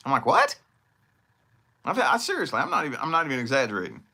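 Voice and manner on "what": high, loud bewildered what; baffled what. Retold or imitated. Lost traveler reacting to directions, high and baffled. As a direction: squeak it out completely lost.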